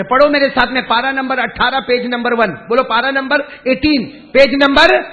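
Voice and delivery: high-energy preacher's shout; preaching at full force. Acted. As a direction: rouse the congregation to join in. Rousing citation before recitation.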